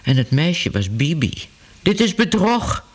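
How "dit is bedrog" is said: higher, accusing cry; Ellemeet calling out. A narrator's reading. Ellemeet accuses the hall of trickery, raised cry. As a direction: cry fraud, pitched up. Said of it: "riep meneer Ellemeet".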